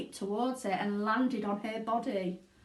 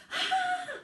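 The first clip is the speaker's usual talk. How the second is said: shrill, very high scream; a mother's horrified scream. A narrator's reading. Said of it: "started to scream".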